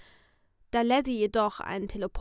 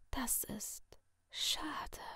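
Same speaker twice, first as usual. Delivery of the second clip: sinister masked figure in a soft whisper; menacing whisper from behind a mask; creepy whisper; 12 dB quieter, much slower than usual. Acted. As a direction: purr the threat like a friendly killer. Letty whispers.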